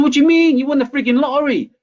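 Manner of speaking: can't believe what you're hearing; incredulous outburst. Retold or imitated. Son astonished at dad's gloom.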